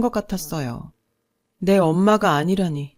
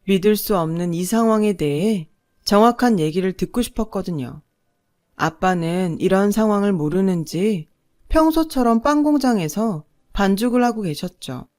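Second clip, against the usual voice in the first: plain reading voice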